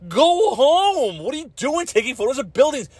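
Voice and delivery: telling the photographers to go home, high mock exasperation; exasperated retort. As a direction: fire back in a squeaky, fed-up mock voice. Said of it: "and it's like".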